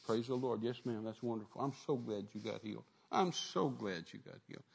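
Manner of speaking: low, soft murmured congratulations; mild acted praise. Acting out those rejoicing at a healing.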